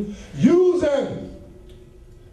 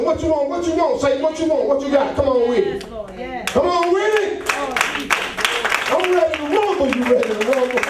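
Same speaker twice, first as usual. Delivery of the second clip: challenging the devil; 7 semitones higher than usual. Acted.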